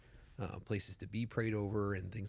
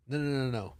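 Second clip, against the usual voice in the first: emphatic no; 5 semitones higher, 10 dB louder, faster than usual. Retold or imitated. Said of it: "And I'm like".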